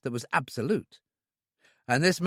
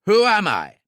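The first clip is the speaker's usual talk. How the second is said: much louder, higher threat; loud demand; 7 semitones higher, 9 dB louder than usual. A narrator's reading. Gunman repeats the question after striking him, loud threat. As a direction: demand it, no patience.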